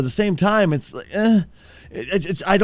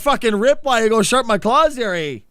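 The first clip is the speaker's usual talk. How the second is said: hammed-up Canadian; 7 semitones higher, faster than usual. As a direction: play a rowdy Canadian Wolverine. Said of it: "if he sounded, like, really Canadian".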